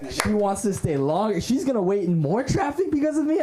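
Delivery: mock-giddy, pitch climbing to a high peak; elated reaction. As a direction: gush in rising disbelief and delight. Retelling elated reaction to date.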